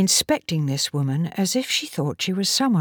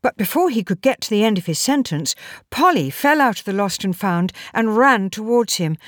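ordinary delivery

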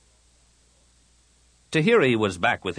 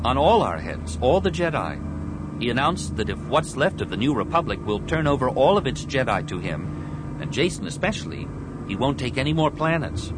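lightly voiced young hero, raised pitch; Anakin; 6 semitones higher than usual. Anakin warns of a price on Jedi heads, earnest and raised. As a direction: report the threat with some urgency, pitched up.

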